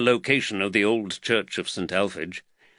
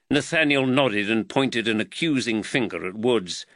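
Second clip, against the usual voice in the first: plain reading voice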